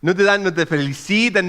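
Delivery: normal talking voice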